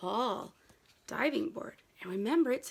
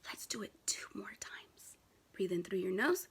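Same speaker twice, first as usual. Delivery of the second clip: soft guiding voice; 4 semitones lower, 10 dB quieter than usual. Real, not acted. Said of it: "leading a breathing exercise".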